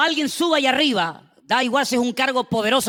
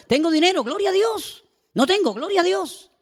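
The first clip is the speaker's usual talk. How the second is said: high, bright mock praise; cheerful believer; 8 semitones higher than usual. Preacher mimics a churchgoer praising God, higher and cheerful. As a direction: chirp it like a happy churchgoer.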